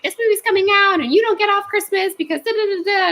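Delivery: high mock-bossy manager voice; mimicking strict manager. Manager refusing time off, high mock-bossy voice. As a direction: squeak it out like the strict boss. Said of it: "was like".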